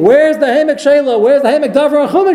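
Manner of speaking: high, loud accusing cry from above; heavenly court screaming. Retold or imitated. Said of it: "they would have started screaming".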